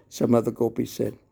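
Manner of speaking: usual speaking voice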